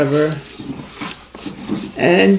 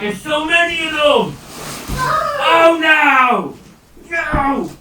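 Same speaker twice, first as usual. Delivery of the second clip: high, loud dismayed cry; real shock at the mess; dismayed shout; 12 semitones higher, 3 dB louder, slower than usual. Real, not acted. Beads spill everywhere.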